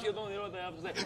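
very soft, quick, lost off the mic; barely audible. Shown as a demonstration. Comic showing a badly placed microphone, barely audible. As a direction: drop almost to nothing, quickly.

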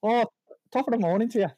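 high-pitched attempt at Irish; Irish accent. Shown as a demonstration. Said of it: "try and do one now".

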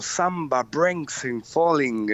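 slow, drawn-out made-up lyric words; fake foreign language. Shown as a demonstration. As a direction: demo of invented-language singing words. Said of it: "canto en un idioma inventado".

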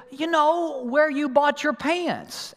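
put-on high, slow little-boy voice; imitating his son. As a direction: spell it out like a frustrated kid. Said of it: "And so he said".

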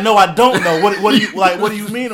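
high, loud, flustered; confused boss voice. Retold or imitated. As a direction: play dumb, rising in panic. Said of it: "he was like".

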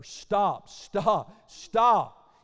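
imitated screaming. Disciples screaming at the approaching leper, imitated shouts. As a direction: shout them off, one word at a time. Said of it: "They're screaming at the leper".

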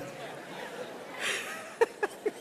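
quiet breathy laugh; soft laugh. Real, not acted. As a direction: let the laugh fade. Laughter trails off. (risa suave)

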